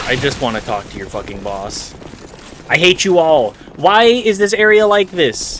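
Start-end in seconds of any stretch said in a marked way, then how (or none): 2.7-5.6 loud, high exasperated yell; frustrated yell. Real, not acted. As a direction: shout your frustration at the game. Frustrated by swarming enemies.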